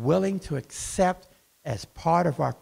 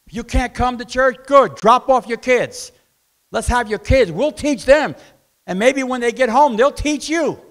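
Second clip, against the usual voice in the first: raised, higher preaching voice; forceful preaching; 6 semitones higher, 6 dB louder than usual. Acted. Preacher urging absent parents to send their kids, forceful appeal. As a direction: press the appeal home, loud and punchy.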